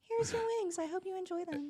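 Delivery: sugary polite, with a hidden eye-roll; mock-sweet waitress handing over wings; fake sweet server. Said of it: "I will be completely sweet".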